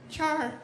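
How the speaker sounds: put-on Chard squeaked high like a little kid; high childish Chard; toddler saying Chard. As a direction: say Chard the way a baby sister would. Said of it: "she's saying Chard".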